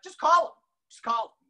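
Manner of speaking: loud, high push to just call; urging shout. Retold or imitated. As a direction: urge the nervous rookie to dial now. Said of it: "And he's like".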